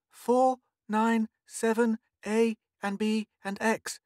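put-on high little girl, earnestly dictating; serious. A narrator's reading. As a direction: dictate solemnly in a high child voice. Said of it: "said Mink, far away, seriously".

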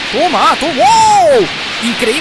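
exclamaciones agudas y fuertes; asombro gritado. Real, not acted. Amazed shouts. (alucinado con el combo, gritando)

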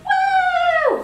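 long, very high woo; high-pitched sustained cheer; celebratory whoop. Real, not acted. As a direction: let out a loud party whoop. Cheering her own thirtieth birthday.

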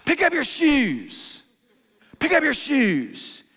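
high, slow barked command; a sharp, drawn-out parental bark; barked order. Retold or imitated. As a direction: snap it out like a tired, irritated dad. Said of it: "you just bark out an order".

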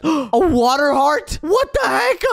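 surprised gasp. Real, not acted. Finds treasure in chest.

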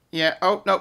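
ordinary talk, nothing marked

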